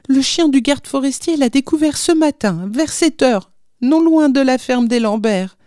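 high-pitched, horrified retelling; distressed young wife. A narrator's reading. Young wife telling husband of the postman's death, distressed. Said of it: "s'écria la jeune femme".

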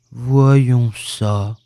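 low, doleful voice; gloomy donkey. A narrator's reading. Donkey about to look for his tail, gloomy. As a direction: say it heavily, deep down.

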